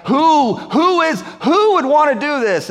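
high, loud outraged demand; a king shouting in drunken rage; outraged who-would-dare cry; furious king. Retold or imitated. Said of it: "he's so mad".

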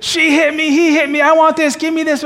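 put-on high, whiny bickering children; squabbling kids. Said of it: "it was just".

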